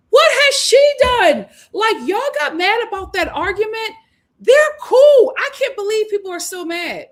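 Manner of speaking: high, loud exasperated outburst; indignant disbelief. Real, not acted. Host defending a contestant against hostile voters, indignant and high.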